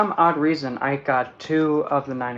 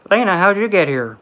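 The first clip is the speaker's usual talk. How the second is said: surprised greeting; 2 semitones higher, 7 dB louder than usual. Retold or imitated. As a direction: greet an old friend by surprise. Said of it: "It's like".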